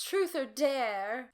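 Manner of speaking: high, loud, slow singsong; Sherry inviting everyone to play; playful girl. A narrator's reading. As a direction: tease the party game.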